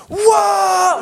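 very high shouted whoa of amazement; shocked whoa. Retold or imitated. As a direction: show the kid the face of total wonder. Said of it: "And I was like".

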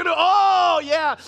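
high, loud cheer; whooping with delight; loud oh yeah. Retold or imitated. Teen cheers while guzzling Cokes, loud and high. Soda binge glee.

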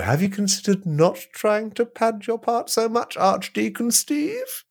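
haughty chairman. Acted. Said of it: "reenact it with you two".

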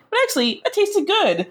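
high-pitched, guiltily delighted; high guilty Caroline voice. Retold or imitated. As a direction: squeak out the guilty confession. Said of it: "But she's like".

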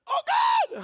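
high, loud overwhelmed shout; epiphany cry. Retold or imitated. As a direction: cry out as God shows up. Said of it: "It's like".

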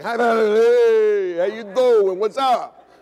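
loud, high, drawn-out hello; mock hearty greeting. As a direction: call out the name, mocking the drawl. Mock greeting to the Southern girl.

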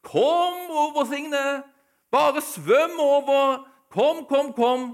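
slow, high, loud call; calling across the river. Retold or imitated. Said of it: "og hun ropte".

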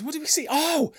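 very high, loud burst; sudden excitement. Real, not acted. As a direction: jump on a new idea. Suddenly remembers a story.

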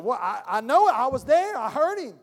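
loud, rising disbelief; indignant protest. Acted. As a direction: protest loudly as the proof falls apart. Accuser protests that evidence vanished.

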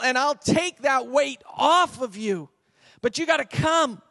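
loud, high, urgent appeal; emphatic. Acted. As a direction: preach it out with full-force conviction. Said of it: "And he's emphatic".

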